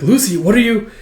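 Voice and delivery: high, startled question; mild panic at a friend's plight; retold worried cry; acted alarm. Friend alarmed as she vanishes.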